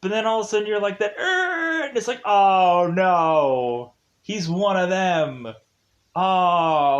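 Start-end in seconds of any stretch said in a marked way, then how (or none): 1.2-1.8 high-pitched mock screech; a sudden held high "err"; alien shriek. Matthew revealed as alien, high shriek. 2.2-7.0 loud, drawn-out "oh no"; dismayed groan. Retold or imitated. Viewer seeing the twist, dismayed groan.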